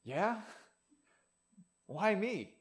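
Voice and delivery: as if a celebrity just called you; stunned disbelief. Retold or imitated. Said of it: "You'd be like".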